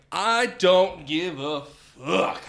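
put-on slow, drawn-out drunken defiance; belligerent. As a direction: drag each word out, sulky and defiant. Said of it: "I'm belligerently mad".

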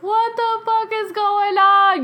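high, slow, drawn-out exasperation; stretched, high-pitched bafflement; re-enacted bewilderment. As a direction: wail it in mock disbelief. Bewildered after hang-ups.

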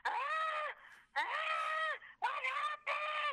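high, slow, drawn-out imitated wail; monster-like wail. Mother imitating the injured girl's confused wail. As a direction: howl it out like a dazed monster. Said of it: "you're making me sound like a monster".

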